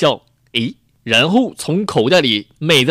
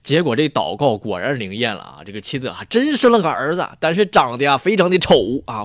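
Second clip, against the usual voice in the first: ordinary talk, nothing marked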